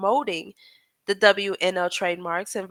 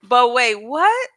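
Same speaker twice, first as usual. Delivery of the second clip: surprised; 3 semitones higher, 6 dB louder, slower than usual. Real, not acted. Shocked by the [name] error.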